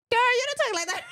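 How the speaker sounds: high and loud mock protest; teasing outburst. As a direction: call her out, laughing. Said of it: "I was like".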